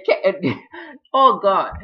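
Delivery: real laugh breaking into the words; high, loud outburst through laughter. Real, not acted. Recapper cracking up while retelling the phone call, laughing.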